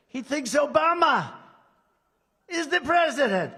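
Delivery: high, loud sarcastic exclamation; mocking a TV host, high loud sarcasm; mock outrage. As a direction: sneer it out as absurd. Mocking the press claim.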